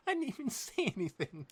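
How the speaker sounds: mock Twilight whine. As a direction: squeak it out like a wronged little pony. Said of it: "followed by Twilight going".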